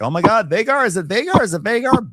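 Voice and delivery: high, excited repeated questioning; breaking out in put-on excitement; mock frantic hope. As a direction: imitate someone waking in a fluster. Aemon wakes and hears a dragon.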